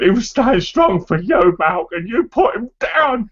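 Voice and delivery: high, slow, tearful voice. Retold or imitated. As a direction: choke it out, crying. Dad retold crying.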